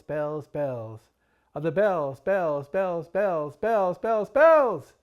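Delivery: frantic crescendo of bells; building bells. Acted. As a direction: build each bells until you're crying out.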